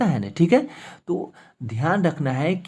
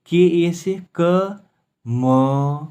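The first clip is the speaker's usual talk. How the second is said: spelled out slowly; 2 semitones higher, 3 dB louder, much slower than usual. Shown as a demonstration. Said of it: "देखिए म आधा है".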